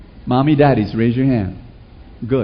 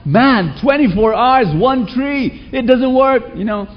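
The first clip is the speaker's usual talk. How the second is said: higher, incredulous salesman; baffled salesman; 9 semitones higher, slower than usual. Acted. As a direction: puzzle over it aloud, pitched up. Salesman puzzled by complaint.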